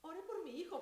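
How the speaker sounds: retold tearful plea. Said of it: "empezaron a salirse las lágrimas".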